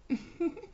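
short teasing giggle; amused giggle. Acted. Bride giggling at her pet, teasing. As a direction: laugh down at him, amused.

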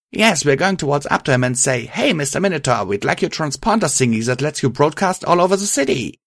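a touch of silly enthusiasm; animated chatter. A narrator's reading. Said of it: "Patch chattered animatedly".

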